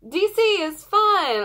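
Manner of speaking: put-on high, loud, gleeful call to the kids. Fan mimicking the singer calling out on tour, high excited shout. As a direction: shout it out high like a chirpy pop-star intro. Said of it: "can't wait to hear her go".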